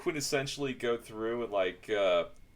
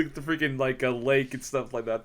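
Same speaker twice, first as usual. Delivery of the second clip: normal talking voice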